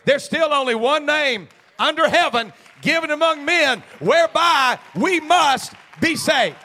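loud and high, building to a full shout; shouted preaching. Acted. Pastor shouting to the church that the devil trembles at the name. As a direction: thunder the name over the church.

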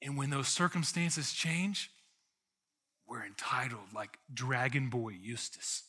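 ordinary delivery

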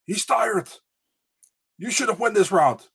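high-pitched imitation of a cornerman; punchy, high mock corner advice, in short bursts; Mark Coleman. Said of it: "Mark Coleman in between the rounds".

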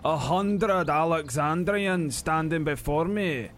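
lightly voiced boastful old dwarf, Scots lilt; dwarf warrior. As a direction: brag like a grizzled dwarf veteran. GM voices dwarf Stout Boots.

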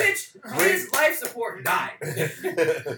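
high, loud opening, slowed for emphasis; exasperated comic rebuke; mock-outraged retort. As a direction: scold the idiots, drawing it out. Acted scolding of the foolish crew.

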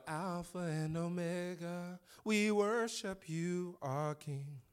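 slow, drawn-out worship phrases; worshipful recitation. Acted. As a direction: lift it up reverently, stretching each word. Reciting worship words reverently.

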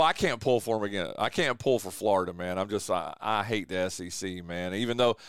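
normal talking voice

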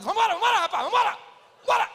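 high, loud hurry-up calls to the husband; impatient wife urging. Retold or imitated. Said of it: "A mulher fala pro marido".

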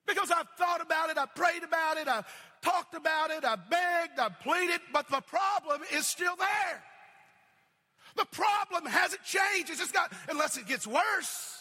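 high, loud, pleading build; a rising cry over the problem that won't change; full-force preaching. Acted. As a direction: preach the frustration to a peak.

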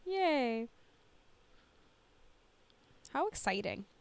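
ordinary talk

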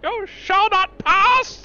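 very high, slow booming wizard; Gandalf. Retold or imitated. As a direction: thunder it like Gandalf.

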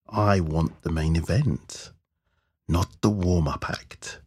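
slow, hushed menace; menacing demand. A narrator's reading. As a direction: say it slowly, as a threat.

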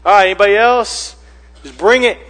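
loud, high taunting challenge; Mark's challenge. Acted. Gospel writer daring any challenger, loud taunt. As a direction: throw down a quick challenge to all comers. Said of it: "it's like Mark's just saying".

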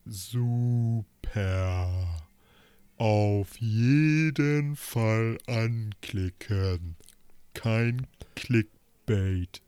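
very slow, stretched words; slow motion. Shown as a demonstration. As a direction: drag each word out slowly. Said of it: "ganz langsam sagen".

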